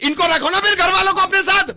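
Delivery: very high, loud angry yell; shouting. Acted. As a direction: shout him down. Phone पर चीखता हुआ झगड़ा. (गुस्से में ज़ोर से चीखते हुए)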